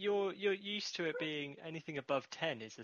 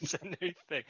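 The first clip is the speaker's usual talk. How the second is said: laughing echo; 4 semitones higher than usual. Pretend. Mocking echo of guest's phrase.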